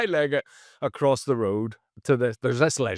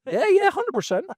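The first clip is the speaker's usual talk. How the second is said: amused agreement; 6 dB louder than usual. Real, not acted. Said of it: "chimpanzee, yeah".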